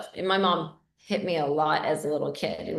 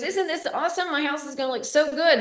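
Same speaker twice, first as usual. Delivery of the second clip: higher gushing mom voice; imitating mom; 7 semitones higher than usual. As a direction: mom delighted at the free labour. Mom gushing about her house.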